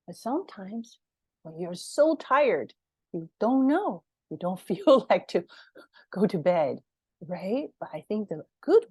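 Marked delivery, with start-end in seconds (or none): none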